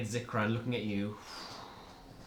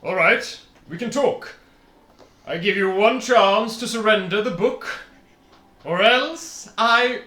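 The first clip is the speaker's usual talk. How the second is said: genie voice; 6 semitones higher, 6 dB louder than usual. Acted. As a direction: boom it out like a genie. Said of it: "oh, I'm using the genie voice".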